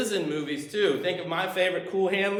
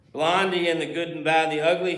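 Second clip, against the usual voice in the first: normal talking voice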